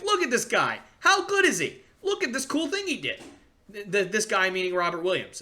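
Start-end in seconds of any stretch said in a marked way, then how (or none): 0.0-3.2 higher, eager hype to his dad; excited fan gushing. Retold or imitated. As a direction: gush like a kid showing off a player. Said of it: "I would show videos to my father and be like".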